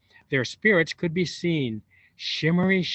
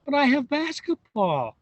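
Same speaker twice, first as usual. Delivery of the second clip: high whiny protest; girl complaining to grandma about basketball; complaining whine; 11 semitones higher than usual. A narrator's reading. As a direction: whine to get out of it. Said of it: "Vivian complained".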